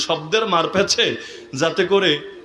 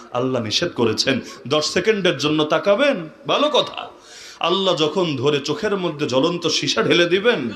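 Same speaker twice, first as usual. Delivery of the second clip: ordinary talk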